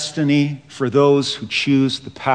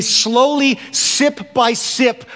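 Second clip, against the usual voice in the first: slow, deliberate; 7 semitones higher, slower than usual. Acted. Slow emphasis on gradual.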